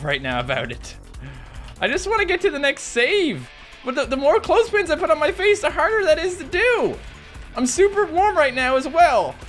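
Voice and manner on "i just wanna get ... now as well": high complaining whine; high strained whine. Real, not acted. Voice pitched up in strain.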